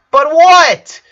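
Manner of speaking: high, loud impatient demand; vehement snap. A narrator's reading. Lori hurrying Lincoln for an answer, loud and vehement. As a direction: cut him off hard. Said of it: "vehemently hurried him".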